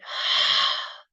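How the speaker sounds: heavy breathy sigh out; loud exhale sigh. Shown as a demonstration. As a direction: let a big breath out with a sigh. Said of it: "and breathing out".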